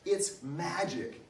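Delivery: high, very slow and emphatic; little girl spelling out the wonder; emphatic, excited child. A narrator's reading. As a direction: reveal the obvious secret, drawing out each word. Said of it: "her voice was emphatic with the excitement".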